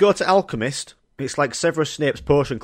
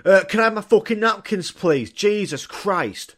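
higher, louder, rude demand for napkins; mock angry customer; 6 semitones higher, 3 dB louder than usual. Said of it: "he went fucking mental towards staff".

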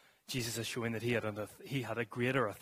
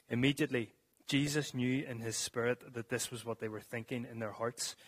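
ordinary talk

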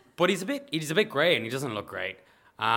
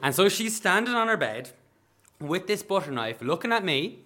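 plain reading voice

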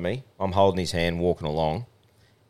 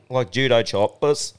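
normal talking voice

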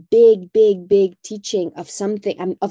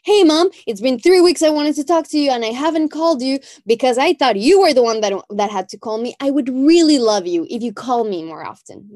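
higher, louder and fast, pouring it all out; imagined call to mom; 5 semitones higher, 6 dB louder, faster than usual. Retold or imitated. A daughter finally phoning her mom, higher, louder, rushed. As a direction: blurt it to mom in one rush, up high and loud.

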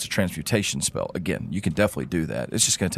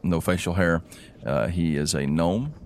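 ordinary talk, nothing marked

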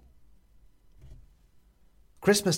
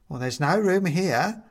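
a hint of a dry, higher retort; lightly voiced Mum; 7 semitones higher, 5 dB louder than usual. Mum correcting Bobs, lightly dry. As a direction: cut him down mildly.

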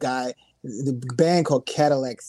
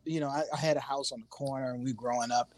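usual speaking voice

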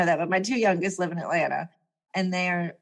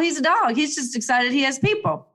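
usual speaking voice

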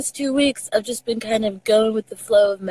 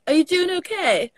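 higher-pitched question with a light British lilt; slight British accent; 7 semitones higher than usual. Retold or imitated. Quoting oneself to Texans, a slightly British accent. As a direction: ask it lightly British-sounding. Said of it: "I still sound a bit too U K".

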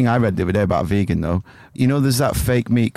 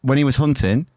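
ordinary talk, nothing marked

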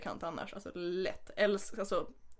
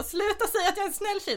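much higher, whiny exasperated plea; annoyed protest; 11 semitones higher, 3 dB louder than usual. Retold or imitated. Girl telling the guys to stop calling her nice, high whiny protest. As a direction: whine it like you're fed up.

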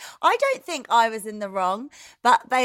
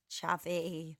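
ordinary talk, nothing marked

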